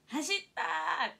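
drawn-out mock shout, slow; retold cheer. Player recalls yelling from the bench, slow drawn-out shout. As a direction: call it out like cheering a runner. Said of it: "走ったーって。".

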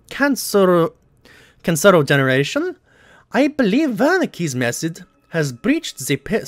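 hammed-up German accent reading a Nazi document; mock German accent. Gamer reads the in-game letter aloud in a mock German accent. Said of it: "speaking in a German accent".

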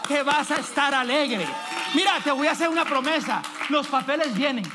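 loud, high, shouted promises to the congregation; fiery prophetic proclamation, building to a shout; full-force preaching. Acted. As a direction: preach it at the top of your voice, rising.

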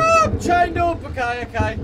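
high, loud cry of alarm, easing slightly; panicked shout. Real, not acted. Streamer finds himself chained up in the game, panicked shout. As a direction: yell it in panic, then try to steady.

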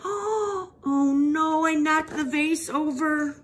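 high, gasping "oh no" in dismay; gasp, then voice the child's shock in a high pitch; storybook child caught by surprise, high-pitched; acted surprise. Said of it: "She's surprised. She's like".

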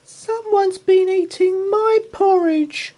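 very high, loud squeak; squeaky Baby Bear. Acted. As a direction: squeak like a tiny upset cub. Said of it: "squeaked Baby Bear".

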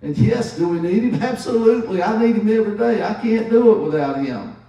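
loud, high, fervent preaching. Acted. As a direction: lift the room with conviction.

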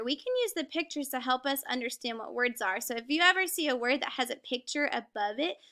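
usual speaking voice

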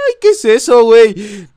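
high, loud startled cry; startled shriek. Real, not acted. Gamer spots a kneeling girl and shrieks high. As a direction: jump at the scare.

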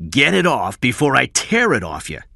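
menacing loud order; shouting. A narrator's reading. Cy orders Kathy to remove her coat, shouting. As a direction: bark it as a threat. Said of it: "Cy shouted".

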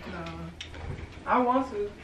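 ordinary talk

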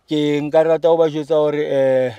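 ordinary talk

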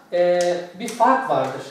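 normal talking voice

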